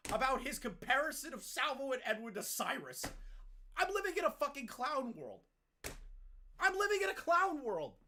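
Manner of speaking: high-pitched exasperated rant; real frustration. Real, not acted. Streamer forced to correct Tipster, real frustration. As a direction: rant high, fed up with it all. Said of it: "hitting my frustration level".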